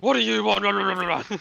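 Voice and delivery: put-on old boomer. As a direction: play an indignant old boomer on the phone. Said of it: "this old boomer guy".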